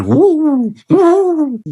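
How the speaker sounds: high, hollow owl hooting; two loud, high hoots; owl hoot imitation. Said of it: "los búhos que hacían".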